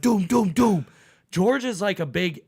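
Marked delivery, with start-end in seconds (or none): 0.0-0.9 loud mock knocking noise; louder imitated door pounding. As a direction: bang it out like fists on a door.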